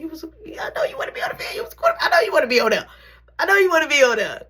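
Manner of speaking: fast, high mocking jab; taunting. Real, not acted. Taunting the bully about football, fast high mocking. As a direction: rub it in, quick and high.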